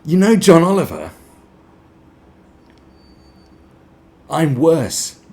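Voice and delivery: high, loud whiny comedian voice; mock comedian. Mocking a comedian comparing himself to John Oliver. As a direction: whine it as a self-pitying comic. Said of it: "Nish Kumar's just going".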